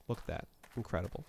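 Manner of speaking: quiet, dry sarcastic aside; mild sarcasm. Acted. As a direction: undersell the praise, deadpan. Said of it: "Mild sarcasm".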